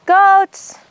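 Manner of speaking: high, loud call across the field. Real, not acted. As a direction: shout it out to the goats. Calling out for goats.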